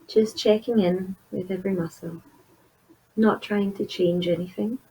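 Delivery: soft, unhurried meditation voice with long pauses; guided meditation. Acted. Therapist leading listeners through a body scan, soft guided meditation. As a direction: gently guide the listener inward, leaving space between phrases.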